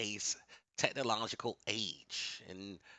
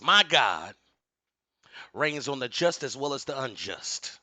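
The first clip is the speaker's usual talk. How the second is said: booming motivational speech; 7 semitones higher, 9 dB louder than usual. Real, not acted. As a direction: thunder it like a revival preacher. Sermon-like warning, asks for amen.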